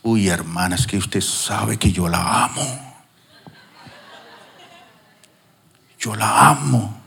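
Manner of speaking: low, crooning flirt with a lingering last yo la amo; a lightly voiced seducer courting a church sister; lustful flirt. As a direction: sweet-talk her like a smitten charmer.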